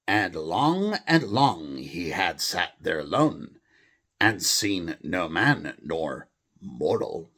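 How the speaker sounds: a put-on English accent, slow and a little loud; amateur English accent reading a poem. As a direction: read it like a stage Englishman, slow. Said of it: "a normal English accent, if I can imitate one a little bit".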